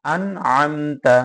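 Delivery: distinct n and m in আন আমতা; every letter clear. Shown as a demonstration. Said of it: "প্রত্যেকটা হরফ স্পষ্টভাবে বোঝা যাবে".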